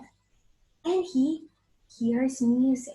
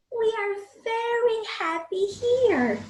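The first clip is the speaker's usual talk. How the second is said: put-on high, bright children's voice, louder; happy children; 8 semitones higher, 5 dB louder than usual. Children in the garden telling everyone, cheerful. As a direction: chirp it out like happy kids. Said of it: "the children say".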